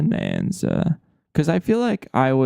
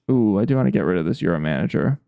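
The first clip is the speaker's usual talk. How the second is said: ordinary talk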